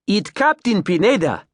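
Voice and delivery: put-on hearty high Creole-accented captain. Captain introducing himself, Creole accent. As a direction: greet them in a big Creole accent. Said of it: "stumped by his Creole accent".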